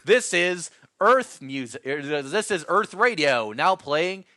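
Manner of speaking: loud, slow fake radio announcer. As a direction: announce it like a cheesy radio host.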